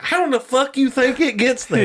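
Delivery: high-pitched retold outrage; incredulous challenge. Challenged over eating meat, incredulous retort.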